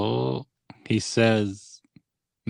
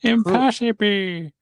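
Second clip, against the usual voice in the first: much higher, slow, hammed-up cry; mock Luke wail; 13 semitones higher, 5 dB louder than usual. Friend joining the meme with a high slow wail. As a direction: drag it out like a wailing Luke.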